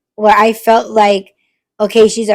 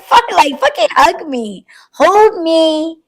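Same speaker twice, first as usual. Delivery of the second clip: mock desperate plea; 6 semitones higher, slower than usual. Begging the guarded people to hug her, mock desperate. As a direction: demand the hug with playful need. Pleading mock demand for hugs.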